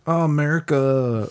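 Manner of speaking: mock Chinese accent, drawn out; imitating a Chinese accent on America. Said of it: "America written in a Chinese accent".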